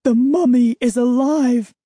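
high, loud terrified shout; panicked cry. Acted. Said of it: "The horrifying thought swept through".

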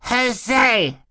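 very high squealed babble; baby's shriek. A narrator's reading. Baby Sonny objects to the plan, shrieking. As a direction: shriek a nonsense word. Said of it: "Sonny shrieked".

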